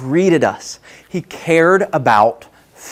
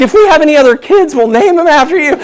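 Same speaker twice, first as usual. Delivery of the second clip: higher and louder, rushing the offer; starstruck gushing; 12 semitones higher, 6 dB louder, faster than usual. Retold or imitated. Promising Jesse Cole to name kids after him, gushing excitedly. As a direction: blurt it out like an eager fan. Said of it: "I'm so excited".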